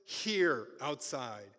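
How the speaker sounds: loud, slow summons; slow commanding call. Acted. Jesus commanding the dead man out, slow loud call. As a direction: draw out the command like calling into a cave. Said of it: "In words in Aramaic, literally".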